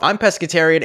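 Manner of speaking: normal talking voice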